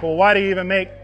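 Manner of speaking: ordinary delivery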